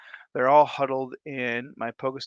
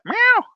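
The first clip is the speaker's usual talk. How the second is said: high, loud cat meow; playful meow; 9 dB louder than usual. Pretend. Greets viewer named Big Cat.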